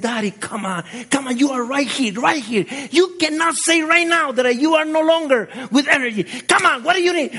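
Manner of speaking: high, pushing daughter's voice; daughter's urging voice. Retold or imitated. Daughter pushing her exhausted father at mile twenty-four, high and urgent. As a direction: urge your worn-out dad to keep going. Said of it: "she gave me massages".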